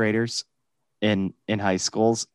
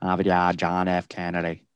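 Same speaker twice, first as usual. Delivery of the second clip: put-on Boston accent; 5 semitones lower, 4 dB quieter than usual. Mocking how Bostonians say Harvard Yard, r-less Boston. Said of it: "there you had the accent".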